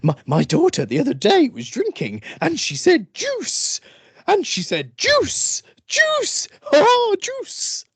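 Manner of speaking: put-on squeaky high child voice on juice; comedian impression. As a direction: mimic a toddler asking for juice. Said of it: "him just going".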